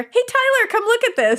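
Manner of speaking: high-pitched call to someone behind; yelling over shoulder. Retold or imitated. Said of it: "instead yelled over my shoulder".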